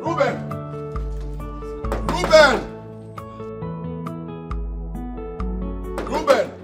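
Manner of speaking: calling out. Acted. Calling out for Reuben.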